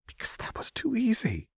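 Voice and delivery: uneasy whisper. Acted. Seraphine whispers her unease to Renata. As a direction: confess it in a whisper. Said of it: "Seraphine said".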